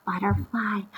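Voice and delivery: soft unvoiced whisper. Real, not acted. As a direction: whisper the answer like a secret to the class. Teacher answers her own question.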